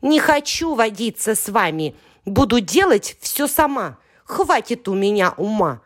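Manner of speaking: put-on childish huff; stamping tantrum. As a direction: sulk like a stubborn child. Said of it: "Я затопала ногами".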